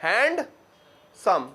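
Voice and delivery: "हैंडसम" stretched slowly into two beats; split into syllables. Shown as a demonstration. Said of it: "दो syllable हैं।".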